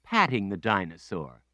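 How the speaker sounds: ordinary delivery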